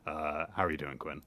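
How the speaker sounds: normal talking voice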